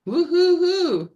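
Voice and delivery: high whooping cheer; cheering woo-hoo. Real, not acted. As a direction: whoop like a happy crowd. Cheering after announcing show.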